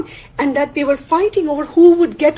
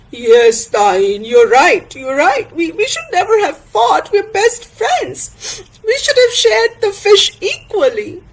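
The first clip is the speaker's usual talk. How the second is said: acted tearful regret, climbing higher; Tana, remorseful; 7 semitones higher than usual. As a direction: admit fault to your best friend, voice rising.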